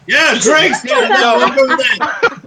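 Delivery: loud, excited cheering. Real, not acted. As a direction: celebrate loudly with a laugh. Player cheers at the table.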